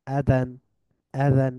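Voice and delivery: usual speaking voice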